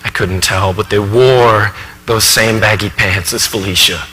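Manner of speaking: subdued stage monologue, weighed down by unease; troubled professor confessing. Acted. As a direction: recount a frightening memory haltingly and softly. Professor haltingly recalls frightening encounter.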